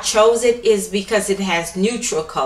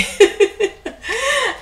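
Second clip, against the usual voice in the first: high burst of laughter; laughing at own mistake; 13 semitones higher than usual. Real, not acted. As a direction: laugh at the slip.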